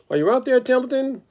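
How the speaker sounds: high pig voice calling out for the rat; lonely pig calling. Acted. Lonely pig Wilbur calls out high for Templeton. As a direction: call out high into the empty barn. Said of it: "called Wilbur".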